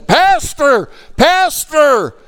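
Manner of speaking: very high, loud excited holler; calling out excitedly. Retold or imitated. Lady running after pastor in church hall, calling excitedly. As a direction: hail him from down the hall.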